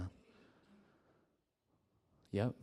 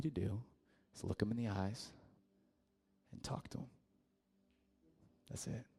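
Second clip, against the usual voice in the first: gentle instruction; 8 dB quieter than usual. Acted. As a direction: softly guide them, fading almost to a whisper. Friend gently teaching how to pray.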